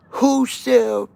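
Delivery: high, loud barking guard; mock guard yell. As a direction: bellow like a prison guard. Guard yelling in retold prison story.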